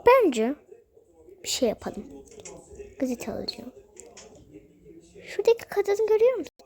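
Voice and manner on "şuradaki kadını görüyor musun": higher, fast little voice; high quick doll voice. Acted. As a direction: rattle it off in a doll voice. Doll character asking.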